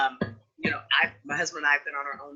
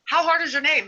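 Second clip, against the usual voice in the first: much higher and louder, disbelieving how hard; incredulous; 11 semitones higher, 6 dB louder than usual. Real, not acted. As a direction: push the question in disbelief. Incredulous repeat to colleague.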